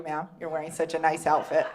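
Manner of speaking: ordinary delivery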